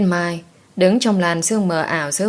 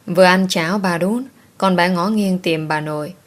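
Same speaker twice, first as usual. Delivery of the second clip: usual speaking voice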